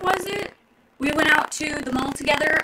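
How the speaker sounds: ordinary delivery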